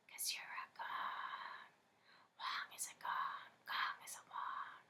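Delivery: eerie whisper. Acted. Inner taunting voice.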